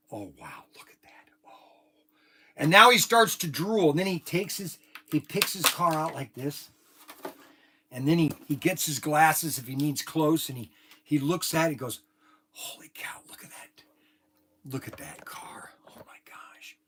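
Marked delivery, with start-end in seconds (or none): 0.1-1.8 breathless whisper of wonder; the guy marvels at his new cars, whispering; awed whisper. Retold or imitated. 12.5-16.8 hushed, breathless wonder; the guy gazes at his Corvette, whispering in awe; awed whisper. Retold or imitated.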